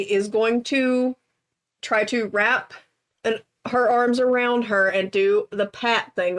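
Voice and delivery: ordinary talk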